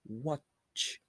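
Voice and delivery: ordinary talk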